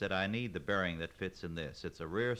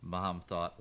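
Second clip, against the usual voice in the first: ordinary delivery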